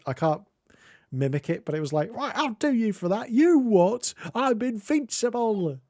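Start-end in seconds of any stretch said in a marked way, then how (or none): 2.1-5.8 high mock Cockney footballer voice; angry Cockney. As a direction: imitate an outraged Cockney fan, pitched up. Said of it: "footballer sort of Cockney voices".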